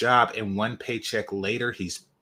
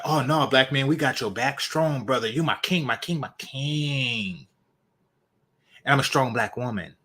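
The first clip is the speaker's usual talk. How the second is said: mock ride-or-die chick; 4 semitones higher than usual. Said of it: "that they hear about on the radio".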